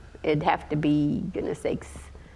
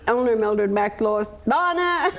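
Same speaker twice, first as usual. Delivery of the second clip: mimicking dad calling the kids, rising to a high yell; calling out names; 5 semitones higher than usual. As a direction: call down the list, then shout the last name. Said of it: "He used to always start out, he'd say".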